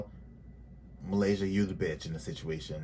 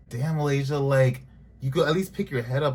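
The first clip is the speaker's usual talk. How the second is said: scolding callout; 5 semitones higher, 5 dB louder than usual. Real, not acted. Scolding Malaysia on screen.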